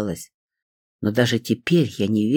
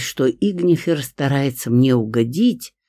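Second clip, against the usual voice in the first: ordinary delivery, nothing marked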